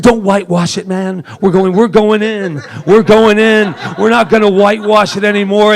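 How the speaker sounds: rally the room, we're going in; fired-up retelling. Retold or imitated. Retells firing up with Rita.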